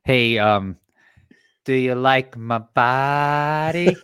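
slow, drawn-out sultry ad voice; Anna Nicole Smith. Retold or imitated. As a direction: purr it out slowly like an old diet-pill ad. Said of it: "that's Anna Nicole".

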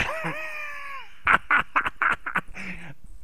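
high shouted laugh. Real, not acted. Big laugh.

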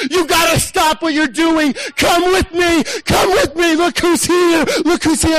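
high, loud summons to the people nearby; urgent calling out. Acted. Preacher acting a disciple calling farmers at the gate, shouting. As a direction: grab them and pull them along.

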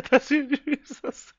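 high breathless giggle over the words; can barely get it out for laughing; wheezing laugh. Real, not acted. Chat roasting his audio.

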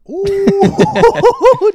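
high, loud teasing ooh; a mock-flirty squeal. Real, not acted. Teasing over a tan.

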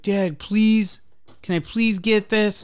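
high-pitched begging voice; imitating a pleading kid. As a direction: beg like a kid asking dad. Retold kid begging his dad.

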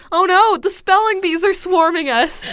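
high, loud playful alarm; hammed-up fleeing from bees; mock panic. Mock panic, bees swarming them.

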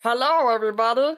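cheery cartoon doctor greeting; Dr. Nick impression. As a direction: sing out a hammy doctor hello. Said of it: "Hi, doktor Nick".

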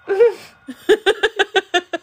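loud, high, rattling laugh; real laughing fit. Real, not acted. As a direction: burst out laughing and keep it rolling. Laughs at game.

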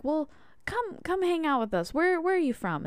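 high, friendly voicing of the guys; friendly invite. Guys invite the upset girl to hang out, high and friendly.

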